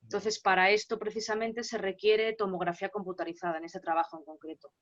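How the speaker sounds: ordinary talk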